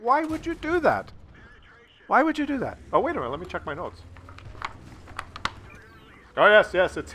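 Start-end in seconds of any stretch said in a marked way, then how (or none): 0.0-2.8 exasperated. Real, not acted. Scolding the teammate.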